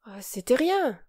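breezy and offhand, brushing it aside; lightly. A narrator's reading. Girl downplaying her bruise to the guilty boy, breezy. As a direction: shrug it off as if it's nothing. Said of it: "dis-je avec légèreté".

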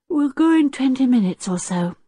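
plain reading voice

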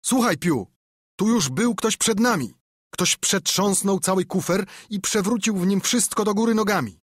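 high, loud shout to someone below; calling out. A narrator's reading. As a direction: call urgently from a window down to the street. Robber calls down from the window.

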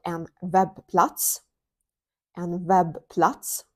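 "en webbplats" very slow, w as v; Swedish V demo. Shown as a demonstration. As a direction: say "en webbplats" slowly with a v sound. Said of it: "вимовлятися як звук В".

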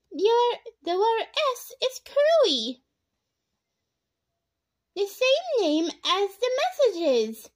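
excited. A narrator's reading. Bess pointing at the autograph.

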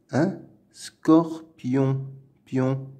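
"Un scorpion" slowly broken into separate syllables. Shown as a demonstration. Teacher splitting a word for young readers, syllable by syllable. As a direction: pause between each piece of "Un scorpion".

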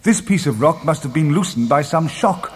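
high, impassioned argument; exclaiming. A narrator's reading. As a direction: insist with mounting fervour. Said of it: "I exclaimed".

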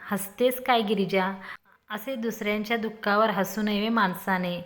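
usual speaking voice

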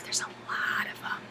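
hushed whisper; soft whispered dread. Real, not acted. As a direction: breathe it as an ominous secret. Whispered ominous aside.

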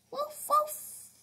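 high playful woof; high puppy bark. Acted. Boy barking as Biscuit.

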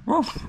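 high, loud mock bark; squeaky pretend woof; playful woof. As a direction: yip like a puppy. Barks back at the puppy.

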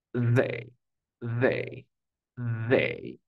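"They" with a light, brief buzz on the th; touch the voiced th in "They" lightly, then move on; subtle, quick th. Shown as a demonstration. Teacher shows students "They" with a subtle voiced th. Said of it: "Hazlo de forma sutil. Rapidito".

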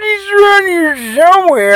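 loud, high put-on voice; playful high searching voice. Gamer hunting through his list for Abra, playful high voice. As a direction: call it out high and silly.

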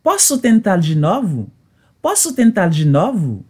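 usual speaking voice

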